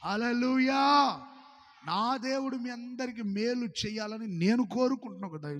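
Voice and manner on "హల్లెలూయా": long, loud drawn-out hallelujah; shouted hallelujah. Real, not acted. Pastor leading the congregation's cry, shouted slow. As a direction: shout it slow to lead the crowd. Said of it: "మరోసారి గట్టిగా చెప్తారా".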